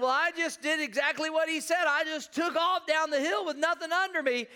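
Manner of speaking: high, loud re-enacted explanation; worked-up protest. Reenacts his pained protest.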